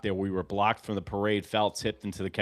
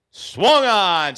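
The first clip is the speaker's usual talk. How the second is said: higher and louder burst on swung; excited call; 9 semitones higher, 10 dB louder than usual. Real, not acted. Fan calling the strikeout pitch, excited burst. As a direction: shout it as the strikeout lands.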